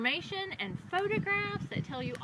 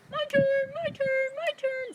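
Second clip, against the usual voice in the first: much higher, insistent little cow voice; eager yellow cow; 13 semitones higher than usual. A narrator's reading. Yellow cow to the farmer, begging in a high eager voice. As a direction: chirp it out like an eager cow at lunchtime. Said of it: "says the yellow cow".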